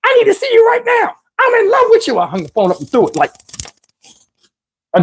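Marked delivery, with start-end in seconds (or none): 0.0-2.2 very high falsetto, pleading; mock her begging in falsetto; high mock woman voice. Woman insisting she loves him, high pleading falsetto.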